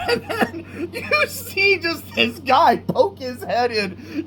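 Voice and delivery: high, slow, squeezed out through laughter; cracking up. Real, not acted. Describing this guy poking his head in, cracking up. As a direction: struggle to tell it while laughing.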